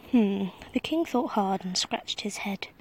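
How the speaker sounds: usual speaking voice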